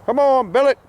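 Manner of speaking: high ringing call to the dog. Real, not acted. Calling a dog to come.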